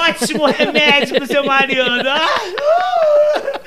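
teasing a listener's wife about her husband, loud and laughing; laughing tease. Real, not acted. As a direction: tease while cracking up. Host jokes, laughing loudly.

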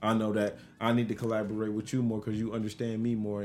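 ordinary delivery

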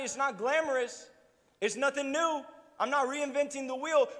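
ordinary talk

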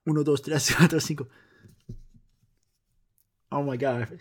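ordinary talk, nothing marked

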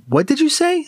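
high, sudden sharp question; startled. Acted. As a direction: stop short in alarm. Crethin startled.

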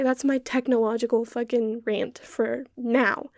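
ordinary talk, nothing marked

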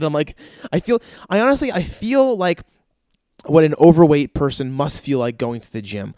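ordinary talk

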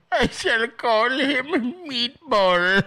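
slowly and grandly, half laughing; mock grand naming. Host echoing the clip to his viewers, mock grand. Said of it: "Is that Trevor?".

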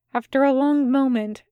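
ordinary delivery

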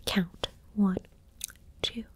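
usual speaking voice

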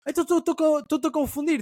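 put-on young guy's voice. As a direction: play the confused stranger, drawn out. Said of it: "E o gajo".

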